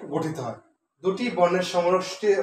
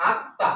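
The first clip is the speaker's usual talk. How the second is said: আত্মা in two distinct beats with a brief gap; a teacher's syllable-by-syllable আত্মা; split into syllables; slower than usual. Shown as a demonstration. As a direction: show both syllables of আত্মা separately. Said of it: "সঠিক উচ্চারণ হচ্ছে এইভাবে".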